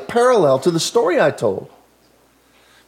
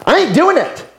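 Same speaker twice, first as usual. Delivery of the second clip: defiant sister; 8 semitones higher than usual. Retold or imitated. Sister defies dad again.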